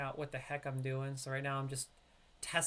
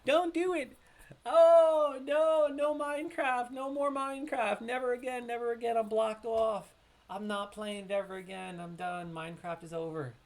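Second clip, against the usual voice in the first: high, loud playful outcry easing down to usual voice; mock protest; 10 semitones higher, 11 dB louder than usual. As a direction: wail in mock horror, then settle. Mock outcry at Minecraft mention.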